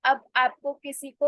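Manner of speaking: ordinary delivery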